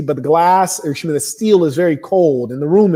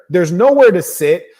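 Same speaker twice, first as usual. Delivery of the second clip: ordinary talk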